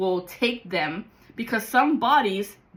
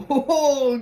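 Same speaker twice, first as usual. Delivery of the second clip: bright burst of laughter, pitched up; startled laugh; 4 semitones higher, 5 dB louder than usual. Real, not acted. Flame jumps at him, he bursts out laughing. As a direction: laugh it off after the scare.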